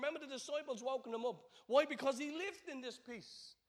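loud, high, fervent preaching; preaching at full force. Acted.

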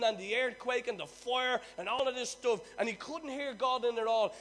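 preaching. Acted. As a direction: preach it with full fire. Preaching at full force.

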